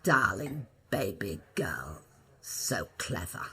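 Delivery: low, slow whispered purr; imitating Olivier. Olivier gently scolding the young actress at the door. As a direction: lean in and croon it low and soft. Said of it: "he leant on the door and he said".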